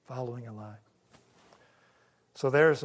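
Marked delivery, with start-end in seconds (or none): 0.1-0.7 soft, hushed repeat of "Following a lie"; quiet echo. Shown as a demonstration. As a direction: let "Following a lie" fall away almost to a murmur. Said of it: "we are following a lie".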